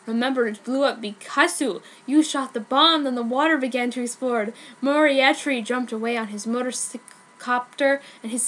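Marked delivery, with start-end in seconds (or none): none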